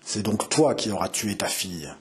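low, restrained menacing growl; growled threat. A narrator's reading. Captor at the window threatening the mother, growled threat.

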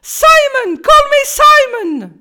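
put-on very high, very loud, English accent; joyful bark. A narrator's reading. Simon insisting the class call him Simon, very high and loud. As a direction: bark your name happily. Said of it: "il aboya joyeusement".

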